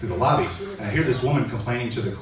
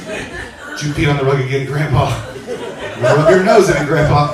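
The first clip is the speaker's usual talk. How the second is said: louder mock scolding of a pet; scolding the dog; 6 dB louder than usual. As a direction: scold the dog named Grandpa. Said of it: "You'd be like".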